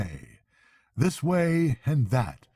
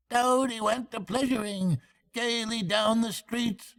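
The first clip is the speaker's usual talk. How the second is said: much higher, squeaky weasel singing; 11 semitones higher than usual. A narrator's reading. Chief Weasel singing his mocking song to the hall, high squeak. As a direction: squeak it out like a gloating little weasel. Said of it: "began in a high, squeaky voice".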